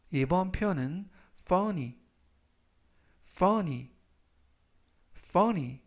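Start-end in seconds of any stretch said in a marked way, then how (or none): 1.4-5.8 funny, clear and falling; teacher models funny carefully; model pronunciation. Shown as a demonstration. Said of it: "라고 발음하시면 되겠고요".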